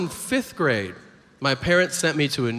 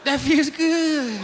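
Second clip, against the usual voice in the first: high, slow moaned words; high-pitched, hammed-up pleasure; mock orgasmic moan; 11 semitones higher, slower than usual. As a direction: draw it out in put-on ecstasy. Orgasm interrupts condolences in bit.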